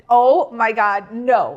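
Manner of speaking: loud, drawn-out retold dismay; slow, emphatic mock horror; appalled reaction. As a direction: act out horrified disbelief.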